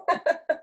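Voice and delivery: a short high burst of laughter; real amused giggle. Real, not acted. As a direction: laugh at your own frustration. Laughs at own exasperation.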